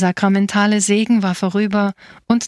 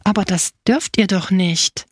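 usual speaking voice

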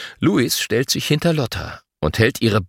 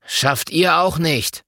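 slow, higher-pitched grudging jibe; sulky retort; 6 semitones higher, slower than usual. A narrator's reading. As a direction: draw it out in mild spite. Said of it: "Leon guckt böse".

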